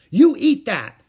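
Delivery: put-on high, very loud bossy child; shouted order. A narrator's reading. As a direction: shout it as a furious command. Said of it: "she shouted".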